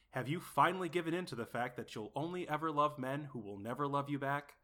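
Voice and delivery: soft, measured commercial voiceover; parody ad read. Acted. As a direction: read it as an earnest, quiet advert. Reads a parody advertisement.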